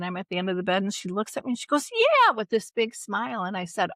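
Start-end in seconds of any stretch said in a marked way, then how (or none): 1.9-2.3 high, loud happy "yeah"; delighted mom. Retold or imitated. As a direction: answer like a thrilled elderly mother. Mom answers with big smile.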